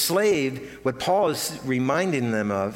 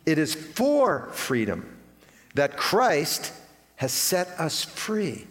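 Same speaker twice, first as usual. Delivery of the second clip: slow emphatic reading; much slower than usual. Shown as a demonstration. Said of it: "Notice that".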